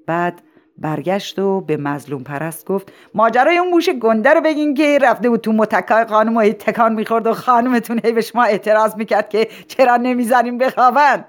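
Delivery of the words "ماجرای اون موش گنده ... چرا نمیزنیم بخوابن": high, loud, running-on anecdote prompt; eager prompting. Acted. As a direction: urge him loudly to tell the funny story. Principal eggs on speaker, loudly. (ترغیب پرهیجان با صدای بلند)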